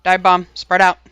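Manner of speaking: loud warning to the team; urgent callout. Real, not acted. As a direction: call it out sharply over voice chat. Raid leader calling out warnings.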